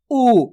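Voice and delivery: उ stretched into a long "ooo"; drawn-out vowel. Shown as a demonstration. Teacher models the big vowel for pupils, long stretched उ. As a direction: hold उ out long. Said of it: "थोड़ा खींच के किया जा रहा है".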